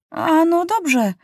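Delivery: put-on boy agreeing. A narrator's reading. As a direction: agree slowly, like a young boy. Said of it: "powiedział Maciuś".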